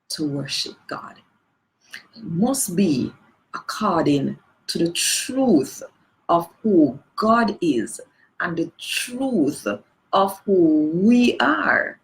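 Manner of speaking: ordinary delivery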